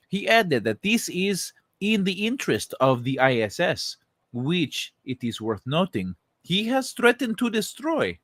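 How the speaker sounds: a brief mock Russian accent before dropping it; half-hearted Russian accent. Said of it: "I'm not reading the whole thing with the Russian accent".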